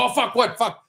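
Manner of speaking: sudden high startled yelp of a curse; retold panic at the wheel; startled swear. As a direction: jolt up in pitch, caught off guard. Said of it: "I was like".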